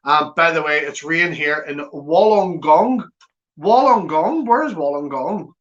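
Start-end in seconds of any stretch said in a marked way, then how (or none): 3.6-5.5 puzzled echo of a strange name; surprised question. Real, not acted. Mock puzzlement at place name.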